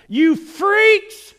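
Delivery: high, loud jeer from a car; screamed insult. Retold or imitated. Passing driver screams at sign holders, loud and high. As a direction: yell it out the window. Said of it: "the guy screams out".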